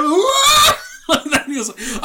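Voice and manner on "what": high, loud crowd whoop; roaring crowd reaction. Retold or imitated. Storyteller mimicking a crowd's shocked reaction, high loud whoop. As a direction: burst out like a whole audience. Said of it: "And the crowd".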